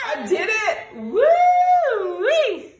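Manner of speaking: high, loud victory whoop; triumphant shout. Real, not acted. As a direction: whoop with a laugh. Mom wins the fight.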